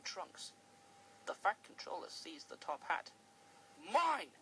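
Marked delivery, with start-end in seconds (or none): 3.8-4.3 a loud, cross snap. Acted. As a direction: claim it back crossly. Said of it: "he said crossly".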